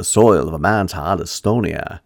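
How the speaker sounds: put-on old New England Maine accent on a haunting line. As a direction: quote it in a Maine accent, dropping the R's. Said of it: "rolls off the tongue so well in a main accent".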